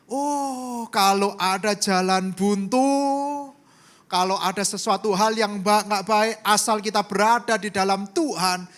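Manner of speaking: loud, ringing pulpit voice; full-force preaching. Acted. Pastor preaching to the congregation, loud and fervent.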